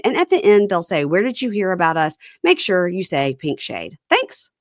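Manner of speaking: upbeat, punchy podcast ad read; sponsor ad read. Acted. Host pitches listeners a clothing sponsor, upbeat ad read. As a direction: sell it to the listeners with emphatic lift.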